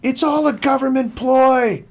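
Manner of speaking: high, strained mock yell; imitated angry yelling. Imitating a kid yelling at a masked runner, mock furious. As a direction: play an irate heckler yelling from far off. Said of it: "he's yelling at me".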